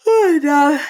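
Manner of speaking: word stretched by a yawn; talking through a yawn. Real, not acted. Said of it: "now I'm yawning".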